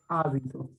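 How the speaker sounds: Hábito, low with the first A stressed; stress on first syllable. Shown as a demonstration. Teacher concluding from the tilde, stress on HÁ in Hábito. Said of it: "sé que es esdrújula".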